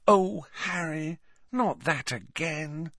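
high, slow, exasperated groan of words; slow, high-voiced impatience with a friend; weary exasperation. A narrator's reading. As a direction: draw it out wearily, pitched high. Friend weary of repeated theory.

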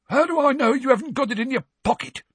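high, loud, fast accusation; suspicious Filch. Acted. Filch accusing Harry of hiding it, high fast voice. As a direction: rattle it off suspiciously.

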